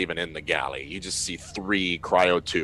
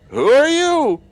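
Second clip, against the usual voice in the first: shouting through the window; 13 dB louder, slower than usual. Acted. Survivor shouts again through window.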